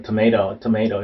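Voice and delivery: TOMATO with a true first T and tapped second, "tuh-MAY-ro"; American "tomato", last T flapped. Shown as a demonstration. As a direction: say "tomato" with the final T as a quick tap. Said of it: "이렇게 발음되고요".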